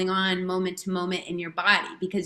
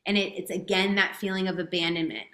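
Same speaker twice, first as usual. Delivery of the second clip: ordinary talk, nothing marked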